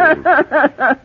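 high tittering laugh; girlish giggle. Acted. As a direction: giggle like a flattered girl. Flattered giggle.

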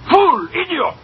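high furious insults hurled at Maxwell; furious shouting. Acted. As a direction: scream each insult at the bookkeeper. Victor explodes at Maxwell.

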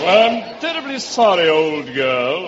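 hammed-up apologetic English gent; English accent. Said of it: "that's a wonderful English accent".

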